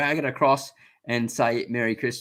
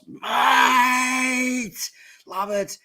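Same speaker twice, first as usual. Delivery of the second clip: excited shout-out; 12 semitones higher, much slower than usual. Real, not acted. Greeting a friend on stream.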